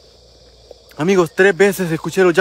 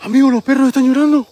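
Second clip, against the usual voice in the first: raised, high-pitched alarm; 6 semitones higher, 4 dB louder than usual. Real, not acted. Ghost hunter telling viewers the dogs are crying, alarmed. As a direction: call it out higher and louder.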